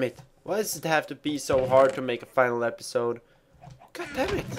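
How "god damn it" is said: squeaky outburst. Real, not acted. Frustrated high squeak.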